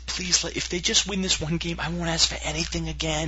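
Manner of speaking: high bargaining prayer; pleading fan. Retold or imitated. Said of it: "sat there every night and said".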